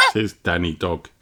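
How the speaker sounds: ordinary delivery, nothing marked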